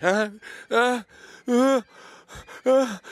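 high, loud choking gasps; gagging gasps. Retold or imitated. Vlogger reenacting gagging on the dentist's mouth block. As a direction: gag and gasp for air, high and loud. Acting out the gag.

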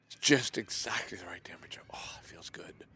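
soft whisper, savouring the warmth; whispered bliss. Real, not acted. Vlogger whispering how good the shower feels. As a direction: breathe it out like pure comfort.

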